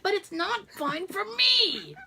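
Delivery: slow complaint climbing high; mock whiny protest. Wife protesting to the doctor, exaggerated whine. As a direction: draw it out into an aggrieved high wail.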